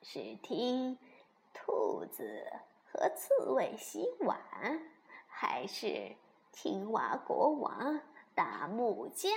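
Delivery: put-on soft, halting old granny voice; 熊婆婆，慈祥安慰. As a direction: reassure the children gently, with long pauses. (婆婆轻声慢慢地安慰)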